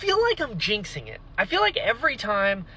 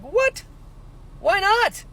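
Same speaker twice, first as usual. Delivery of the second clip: shocked disbelief; 13 semitones higher, slower than usual. Retold or imitated. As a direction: hit it like you can't believe it. Reacting to mom refusing.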